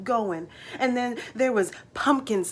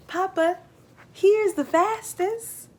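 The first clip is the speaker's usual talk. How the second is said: put-on a kid proudly showing off his horse; young Jake's eager voice; 10 semitones higher, 5 dB louder than usual. Jake tells Papa John the mare is fastest, high eager boy voice.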